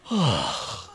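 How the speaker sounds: long falling moan of a sigh; groaning sigh. Shown as a demonstration. Pastor mimicking roommate Jim, a groaning sigh. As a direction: sigh like a roommate who groans all day. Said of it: "You sound like Jim".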